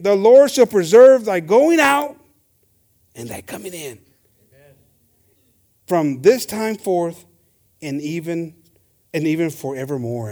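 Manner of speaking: slow, measured verse with spaced phrases; scripture reading. Real, not acted. Preacher reading the Lord's promise to church, solemn scripture reading. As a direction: proclaim the first phrase, then settle down.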